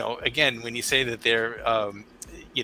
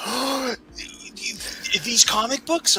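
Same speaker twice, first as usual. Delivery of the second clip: put-on high, breathless kid's question; excited kid; 13 semitones higher, 4 dB louder, slower than usual. A father replaying his son's thrilled question, high and excited. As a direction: voice a thrilled boy. Son amazed by comic box.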